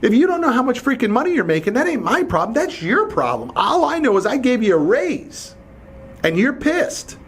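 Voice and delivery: high, mocking brush-off with a laugh; dismissive scorn. Boss scolding employee over his pay, high mocking scorn. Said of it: "I'm like".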